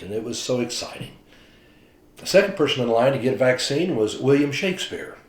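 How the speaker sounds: usual speaking voice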